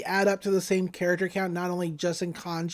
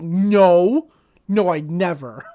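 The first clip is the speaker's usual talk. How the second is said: loud, drawn-out mock protest; mock loud denial; 8 dB louder, much slower than usual. Retelling her denial that she is cheering him up, mock loud protest. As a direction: blurt out an exaggerated denial, much louder. Retold indignant denial, much louder.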